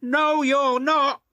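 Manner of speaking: high, slow, loud firm reassurance; puffing James. Acted. James reassuring Thomas, high loud firm voice. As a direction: stop his friend's worry. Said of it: "puffed James".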